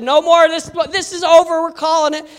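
retold, insistent ending of a bout; urgent stoppage. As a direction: call the fight off urgently. Rival trainer stopping the fight.

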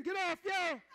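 very high, very loud shout; preacher at full force, shouting. Real, not acted. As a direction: peak of the chant. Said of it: "I gotta say it again".

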